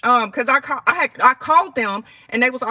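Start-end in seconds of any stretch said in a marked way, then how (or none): none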